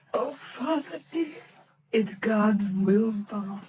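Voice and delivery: high, tearful family voices; grieving Cratchit mother and daughter. Acted. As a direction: mourn the lost boy through tears. Family voices comfort Bob.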